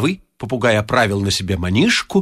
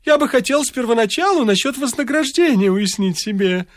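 put-on fussy old man; 10 semitones higher than usual. A narrator's reading. Varfolomeich continues.

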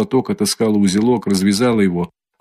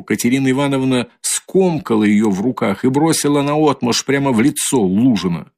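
ordinary delivery

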